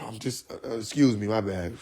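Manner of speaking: soft sheepish excuse after being caught yelling; sheepish quiet apology. Retold or imitated. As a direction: back down, embarrassed.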